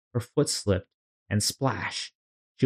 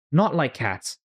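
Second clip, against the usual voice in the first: shrill mouse voice; 6 semitones higher than usual. Acted. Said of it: "cried the mouse in a shrill, harsh voice".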